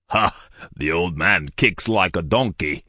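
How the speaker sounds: mildly mocking laugh, voiced giant; amused giant. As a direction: scoff at the priest's kick.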